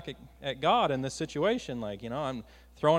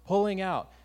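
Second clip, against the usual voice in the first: ordinary talk, nothing marked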